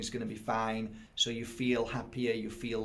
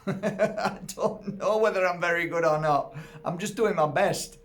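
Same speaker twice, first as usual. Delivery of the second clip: high, loud, laughing it off; real laugh through the words; 6 semitones higher, 7 dB louder than usual. Real, not acted. Laughing, admitting to the interviewer she may not be good.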